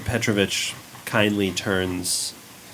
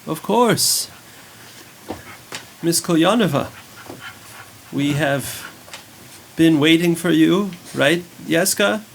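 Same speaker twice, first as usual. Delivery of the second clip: higher, welcoming host voice; priest greeting; 8 semitones higher, 4 dB louder than usual. Acted. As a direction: greet the guest graciously, pitched up. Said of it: "turns and says".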